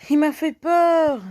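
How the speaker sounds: startled high yelp, slow; scared horror game streamer. Real, not acted. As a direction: jump out of your skin. Il m'a fait peur.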